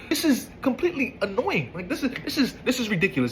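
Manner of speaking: high, incredulous laugh; exasperated laugh. Real, not acted. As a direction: laugh it off, fed up. Ranting, laughs in disbelief.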